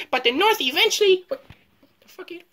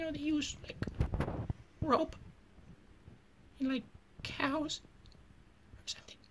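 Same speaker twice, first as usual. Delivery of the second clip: whispered aside; 16 dB quieter than usual. Real, not acted. Trailing off whispered.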